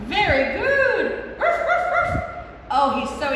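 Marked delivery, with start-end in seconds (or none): 0.1-1.4 high, slow, delighted praise; excited praise. Acted. Praising puppet warmly. 1.4-2.2 high yappy puppet bark; dog bark. Pretend.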